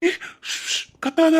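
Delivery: high, urgent hush; panicked shushing. Acted. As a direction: hush someone who is calling out. Hushes her as she shouts.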